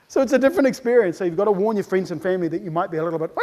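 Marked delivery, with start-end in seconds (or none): none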